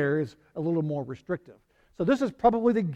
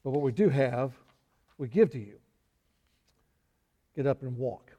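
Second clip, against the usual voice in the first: solemn Peter; 4 semitones lower, 3 dB quieter than usual. Retold or imitated. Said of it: "he says".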